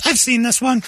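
high, breezy dismissive wife; mock bored wife. Wife brushing off husband over divorce talk, high and dismissive. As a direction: brush it off like a tired rerun. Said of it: "like she's watching a bad Seinfeld".

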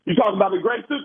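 ordinary delivery

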